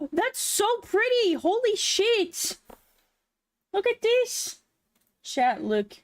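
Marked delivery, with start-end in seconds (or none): 0.1-4.6 high, gushing amazement; excited. Real, not acted. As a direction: rave about the art. Amazed at art.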